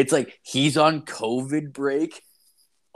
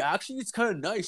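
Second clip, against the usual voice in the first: high, softer mock-contented voice; imitating his buddy; 10 semitones higher, 5 dB quieter, faster than usual. Buddy defends his stay-at-home life, imitated contentment. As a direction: play the buddy pleased with himself, pitched well up. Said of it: "He's like".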